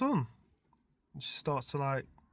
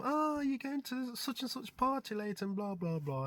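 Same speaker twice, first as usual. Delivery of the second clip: breezy invitation, a bit higher; young woman's voice; 10 semitones higher, 4 dB quieter than usual. Retold or imitated. Girl inviting his son to a party, lightly voiced higher. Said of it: "she goes".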